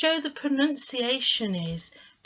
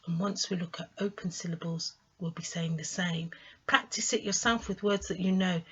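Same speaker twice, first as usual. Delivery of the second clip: ordinary talk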